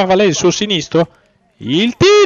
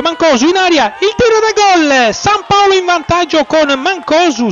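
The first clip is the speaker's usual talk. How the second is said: excited goal call; 7 semitones higher, 4 dB louder than usual. Real, not acted. Goal scored, live commentary.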